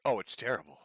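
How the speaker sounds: ordinary talk, nothing marked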